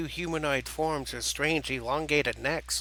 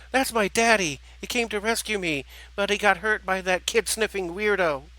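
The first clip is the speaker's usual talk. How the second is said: put-on high, excited child's voice; excited little girl; 6 semitones higher, 3 dB louder than usual. Little girl telling of her daddy's rescue, excited. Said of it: "answered with excitement in her voice".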